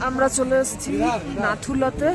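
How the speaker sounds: calling out in the cold; loud. Real, not acted. Calling out on the windy pass.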